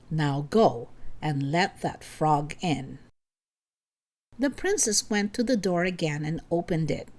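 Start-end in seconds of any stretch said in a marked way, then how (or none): 0.1-3.0 low, slow commanding king; stern father. A narrator's reading. King orders daughter.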